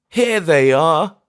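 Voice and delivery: high, slow, loud grief-struck cry. Acted. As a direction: cry it out over the graves. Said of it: "he cried".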